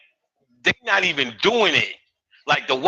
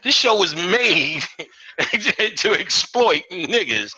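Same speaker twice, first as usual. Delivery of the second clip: slow emphatic quote; 3 semitones higher, slower than usual. Retold or imitated. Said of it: "then [name] was like".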